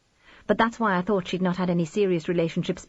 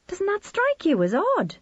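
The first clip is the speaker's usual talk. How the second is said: lightly voiced mother Edie; 14 semitones higher than usual. A narrator's reading.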